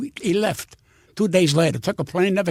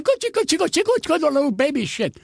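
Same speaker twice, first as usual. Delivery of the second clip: high, sing-song cooing; exaggerated goochie-goo voice; mock baby talk; 7 semitones higher, faster than usual. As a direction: coo frantically high, as at a baby. Imitates cooing at crying baby.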